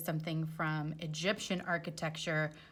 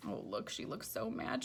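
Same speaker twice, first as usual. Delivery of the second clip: ordinary delivery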